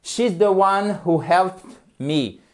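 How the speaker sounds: slow, careful example; slow English example; slow model sentence. Shown as a demonstration. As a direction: spell out the example slowly.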